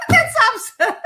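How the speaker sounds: high bursts of laughter around the words. Real, not acted. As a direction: laugh it off as absurd. Laughing at own lion joke.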